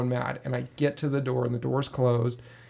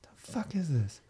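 spooked whisper; 3 semitones higher, 7 dB quieter than usual. Retold or imitated. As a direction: breathe it out, unnerved. Spooked by the figure.

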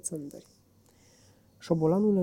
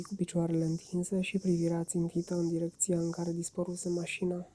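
usual speaking voice

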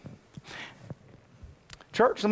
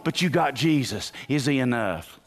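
ordinary talk, nothing marked

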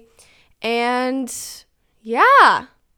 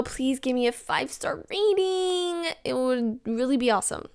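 obnoxious voice; 5 dB quieter than usual. Acted. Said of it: "in the most obnoxious voice ever".

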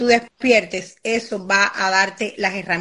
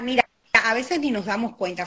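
usual speaking voice

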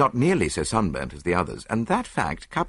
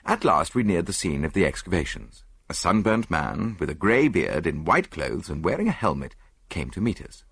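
ordinary delivery, nothing marked

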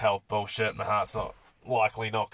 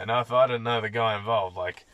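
ordinary delivery

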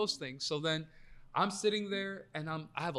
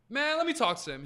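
high, louder mock exasperation; retold dismissive takeover; 9 semitones higher, 6 dB louder than usual. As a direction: imitate a friend waving it off, pitched up. Retold friend brushes off the worry.

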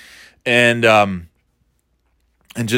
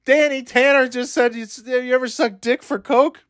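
high, stunned Oh my God, pitched way up; gasping at what Danny Tanner just said; shocked disbelief; 11 semitones higher than usual. Retold or imitated. Fan in the theater reacting in shock, high and stunned. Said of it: "that was the first time I'm like".

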